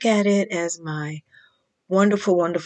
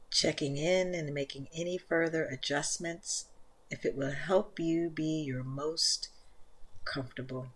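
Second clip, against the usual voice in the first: soft, unhurried meditation voice, much softer than the intro; guided meditation; 11 dB quieter than usual. Acted. Leads guided yin yoga meditation.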